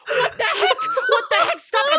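high-pitched squealing protest; acted-out horrified shriek; mock panicked yell. Retelling his panicked reaction to being peed on.

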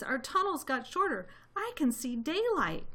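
high little voice, alerting the others; small fox. A narrator's reading. Small fox spotting daylight in the tunnel, high-voiced alert. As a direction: pipe up high, like a little one.

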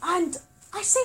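normal talking voice

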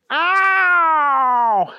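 long, high, loud cartoon howl; shouted in pain. A narrator's reading. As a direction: howl from a double kick. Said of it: "he shouted".

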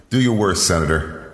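mobster voice. Acted. Mobster boss to senator.

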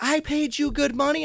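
high, loud mock indignation; mock angry customer. Customer yelling at the owner, loud mock indignation. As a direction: shriek it like the offended customer.

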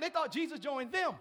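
ordinary talk

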